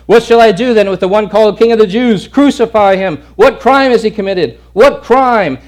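high, loud pleading shout; heated shouting. Retold or imitated. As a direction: argue with a furious mob. Pilate and crowd exchange.

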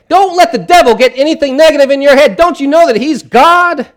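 high, loud, preachy exhortation; loud and obnoxious. Retold or imitated. Stranger in the waiting room preaching loudly at the grieving uncle. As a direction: preach it at him over the grief. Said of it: "just loud and obnoxious".

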